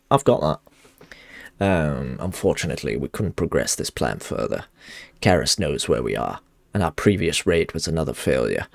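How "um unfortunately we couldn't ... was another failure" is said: low, even reading of a quest giver's plea; game dialogue read. Acted. Streamer reading a quest NPC's lines to viewers, low and steady. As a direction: read the NPC's warning steadily, a touch solemn.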